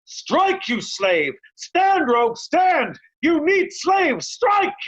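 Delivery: sharp high barked commands; furious challenge. Acted. Kent forcing Oswald to fight, furious high commands. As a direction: goad a coward into fighting.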